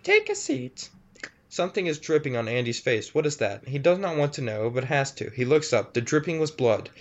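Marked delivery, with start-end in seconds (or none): 0.0-0.9 very high, sweet-creepy monster mother. Acted. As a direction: coo like a ghoulish mom calling her son. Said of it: "says the creature".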